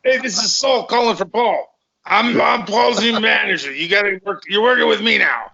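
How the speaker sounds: high put-on manager voice; Saul. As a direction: pitch it up as a made-up business manager. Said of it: "And I would do this voice".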